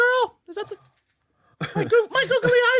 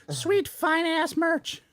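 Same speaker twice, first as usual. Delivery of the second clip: ordinary delivery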